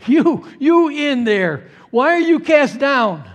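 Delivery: loud, high, drawn-out challenge; calling to oneself. Acted. Psalmist questioning his downcast soul, loud acted call. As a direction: demand an answer from your own soul. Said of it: "this is self-talk".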